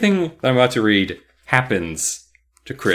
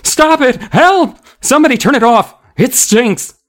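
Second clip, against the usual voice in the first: high, loud panicked crowd voices; screamed cries; 12 semitones higher, 7 dB louder than usual. A narrator's reading. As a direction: scream out in disgust and alarm. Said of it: "Voices screamed and cried out".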